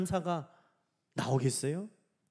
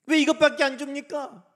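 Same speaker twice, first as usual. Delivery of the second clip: high, loud grievance; acted discontent, voice raised; open complaint; 6 semitones higher, 7 dB louder than usual. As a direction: protest it out loud. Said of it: "불평은요".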